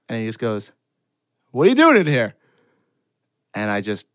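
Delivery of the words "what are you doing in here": high, loud teacher's question; raised voice of a baffled chaperone; incredulous demand. Acted. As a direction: call it out, startled and accusing. Acts out chaperone's startled demand.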